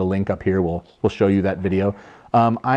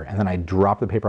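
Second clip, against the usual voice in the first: normal talking voice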